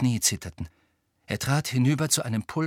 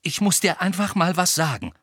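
higher, nervous determination; anxious but resolute; 7 semitones higher, 4 dB louder than usual. A narrator's reading. Hermine prepares to confront Harry, anxious but resolved. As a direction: get it out despite the nerves. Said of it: "sagte sie ängstlich, aber entschlossen".

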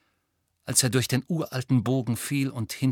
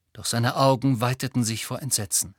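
plain reading voice

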